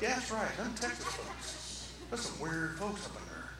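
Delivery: very soft gossipy aside; muttered agreement. Acted. Preacher mimics congregation gossiping about Texans, murmuring. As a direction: murmur it behind someone's back. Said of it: "Everybody's like".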